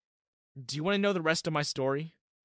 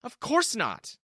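high, louder instant no; flat refusal; 10 semitones higher, 5 dB louder, slower than usual. Acted. Terrence rejecting Diana instantly, loud and firm. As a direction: cut her off sharply. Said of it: "without missing a beat".

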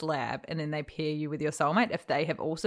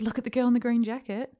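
excited pointing out; 6 semitones higher, 4 dB louder, faster than usual. Retold or imitated. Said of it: "I said".

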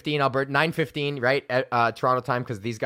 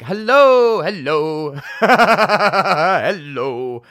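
loud, higher hello with a laugh; loud greeting; 4 semitones higher, 9 dB louder, much slower than usual. Real, not acted. Comedian greets the Zoom audience with a loud laughing hello. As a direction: burst in greeting the whole crowd.

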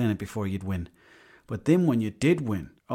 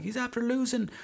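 imitated young brothers; 3 dB quieter than usual. Brothers explaining the noise to their father, high imitated protest. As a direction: pitch it way up as a kid explaining to dad. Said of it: "myself and my brother were like".

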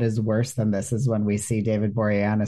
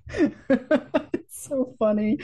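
high, drawn-out words through laughter; real giggly amusement; tickled, laughing; 12 semitones higher, much slower than usual. Real, not acted. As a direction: say it slowly while breaking up laughing. Laughing at a bizarre accent choice.

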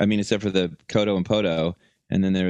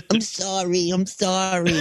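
higher, meek apologizing voice; a cowed old man saying sorry; whimpering Fauci; 11 semitones higher than usual. Pretend. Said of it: "he'll, like".

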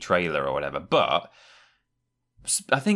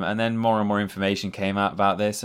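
normal talking voice

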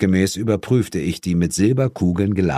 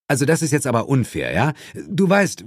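quick, higher-pitched objection; indignant protest; 6 semitones higher, faster than usual. Acted. Friend protesting partner's unfair question, indignant. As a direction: object hotly in a rush.

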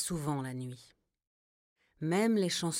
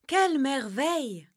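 higher, louder delighted exclamation; exclaiming grandma; 9 semitones higher, 8 dB louder than usual. A narrator's reading. Grandma bear admiring the spider's web, higher and louder delight. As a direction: marvel out loud at the web. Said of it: "s'exclama grand-mère ours".

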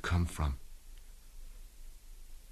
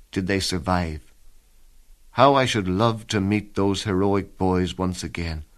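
ordinary talk

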